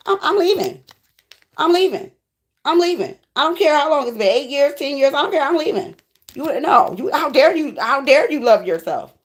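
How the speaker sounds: high-pitched, hammed-up huffy protest; mock outrage. Spurned lover announcing the walkout after being refused, mock outrage. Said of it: "They said".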